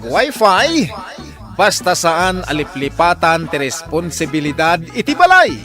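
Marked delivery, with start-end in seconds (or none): none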